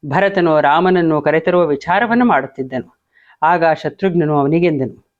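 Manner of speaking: usual speaking voice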